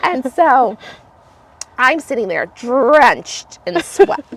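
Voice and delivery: ordinary delivery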